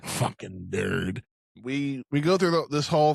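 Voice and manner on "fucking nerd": "Fucking nerd" dragged out slow and low in a put-on voice; slow deep mock sneer. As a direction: drawl it low like a bully's insult. Insult repeated for comic effect.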